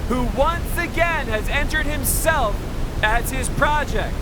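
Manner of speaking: high, loud showman announcement; announcer voice. Acted. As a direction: present it like a fair barker. Presenter introduces the generator.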